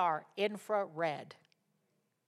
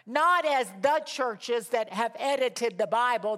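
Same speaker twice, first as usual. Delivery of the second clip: slow, loud, raised declamation; forceful preaching; 5 semitones higher, 7 dB louder, slower than usual. Acted. Preacher setting scripture against tyrants and churches, forceful declamation. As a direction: hammer each contrast with weight.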